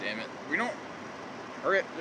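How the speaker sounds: ordinary delivery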